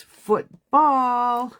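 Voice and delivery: slow, loud teaching stress on football; imitating husband's emphasis. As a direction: say football like a dad drilling a new word. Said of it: "puts emphasis on part of the word".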